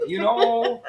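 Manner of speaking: put-on high, loud Russian accent. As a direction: nudge them as a Russian schemer. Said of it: "it's Russian".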